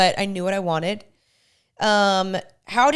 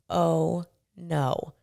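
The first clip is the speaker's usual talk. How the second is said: sinking realization; 5 semitones lower, 6 dB quieter than usual. Retold or imitated. Sudden realization of lost seat.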